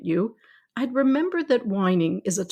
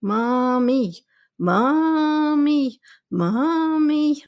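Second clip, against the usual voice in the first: put-on building child's whine, each Mommy higher and louder; whining toddler; 6 semitones higher, 4 dB louder, much slower than usual. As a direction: mimic a kid nagging for attention, escalating. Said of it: "started whining again immediately".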